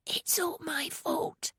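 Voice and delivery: slow guilty whisper. A narrator's reading. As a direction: breathe it out, barely voiced. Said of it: "he whispered".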